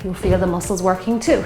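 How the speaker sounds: usual speaking voice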